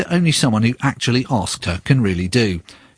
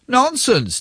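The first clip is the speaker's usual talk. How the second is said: mock royal shout of nonsense; 14 semitones higher than usual. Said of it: "given to shouting".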